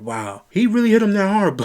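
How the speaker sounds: high, loud retold amazement; amazed reaction. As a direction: replay your stunned gasp at the hit. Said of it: "And I was just sitting there like".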